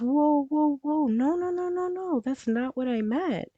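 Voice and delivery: high, quick mock whoa to soothe her; playing the boyfriend backing off, reassuring; retold boyfriend calming. Boyfriend calming his crying girlfriend, high hurried reassurance.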